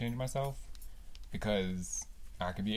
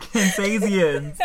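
Kansasians drawn out slowly, higher and louder; mocking repeat; 8 semitones higher, 9 dB louder, much slower than usual. Real, not acted. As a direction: savour the silly word to tease her. Teasing the made-up word.

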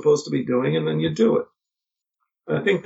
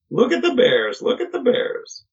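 high mock tourist voice, then a thin breathy echo; imitating a gawking cartoon tourist; funny voice; 10 semitones higher than usual. Father mocking mother's delight in a high funny voice. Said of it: "my father would say it in that funny voice".

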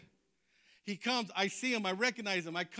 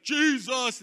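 louder, full-voiced mock scream; his own scream; 3 semitones higher, 6 dB louder than usual. Defending how he screamed out, full-voiced imitated cry. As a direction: cry it out boldly, as he remembers it. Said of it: "to me, it was like".

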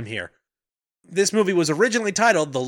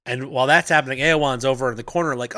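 ordinary talk, nothing marked

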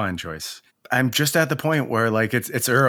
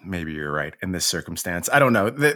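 ordinary delivery

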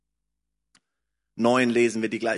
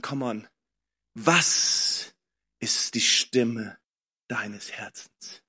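normal talking voice

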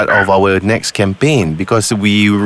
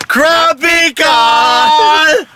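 drawn-out shout pitched up high; show reveal shout; 3 dB louder, much slower than usual. Acted. Radio host springs the prank call on the caller, shouting.

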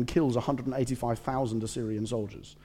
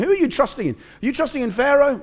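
high-pitched, louder mocking questions; incredulous taunt; 10 semitones higher, 5 dB louder than usual. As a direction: throw it out as a scoffing challenge. Rabshakeh taunts God's people.

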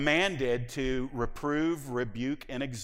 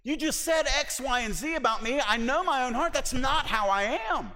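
indignant protest; 12 semitones higher, 4 dB louder than usual. Retold or imitated. Acting out an offended believer.

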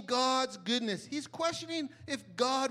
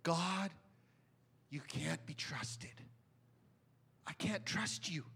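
quiet despair; 4 semitones lower, 6 dB quieter than usual. Retold or imitated. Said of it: "he was gonna say".

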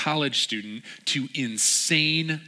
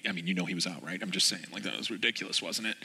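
lower, much softer grumble; whispering complaint; 5 semitones lower, 7 dB quieter, faster than usual. Retold or imitated. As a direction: murmur the complaint under your breath. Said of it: "this whispering of".

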